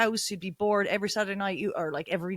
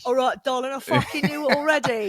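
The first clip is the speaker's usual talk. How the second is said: higher, louder mock-London accent; 5 semitones higher, 5 dB louder than usual. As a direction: put on a hammed-up London voice. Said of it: "That's a London accent".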